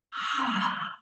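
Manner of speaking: audible, falling sigh of release; demonstrated exhale. Shown as a demonstration. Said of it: "just as simple as the exhale".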